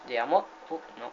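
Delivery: ordinary delivery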